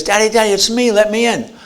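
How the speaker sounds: put-on a little boy crying out to be let in; pleading son. Son outside the door begging his father, loud.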